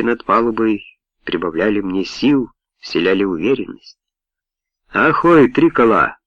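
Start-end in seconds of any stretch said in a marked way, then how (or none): 4.9-6.2 loud metallic hail over the water; booming megaphone call. A narrator's reading. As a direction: boom it through a distant megaphone. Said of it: "прогремел над водой металлический голос из далёкого мегафона".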